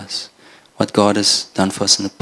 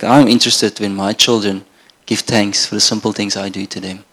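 ordinary talk, nothing marked

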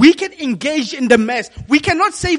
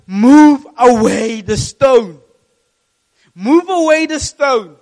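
commanding Jesus; 9 dB louder, slower than usual. Acted. Jesus commanding the stone moved.

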